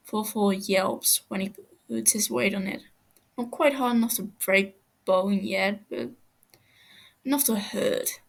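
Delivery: ordinary delivery, nothing marked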